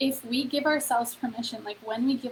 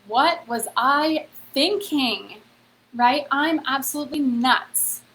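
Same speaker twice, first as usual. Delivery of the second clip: mock disbelief; 2 semitones higher, 6 dB louder, slower than usual. Looking back at goal, disbelief.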